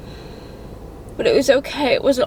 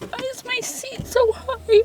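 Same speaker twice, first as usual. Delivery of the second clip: teary; 13 semitones higher than usual. Real, not acted. Shaken after dentist, cry.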